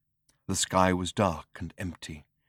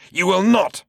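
defiant shout; 10 semitones higher, 7 dB louder than usual. Acted. Zaphod defiant.

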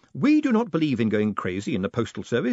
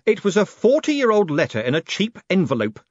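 irritated, raised snarl, pitched up; 9 semitones higher, 6 dB louder than usual. A narrator's reading. Moist answering the little postman about the letter, snarling. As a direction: snarl it loudly back at him. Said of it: "he snarled".

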